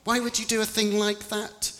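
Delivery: put-on high, incredulous young angel; a young angel, shocked and disbelieving; little angel voice. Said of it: "The little angel's face wrinkled in disgust".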